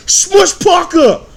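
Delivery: loud, slow exasperated yell of the name; shout. Real, not acted. Ranting to viewers about Kobe's teammates, slow outraged shout. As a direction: bellow it out in disbelief.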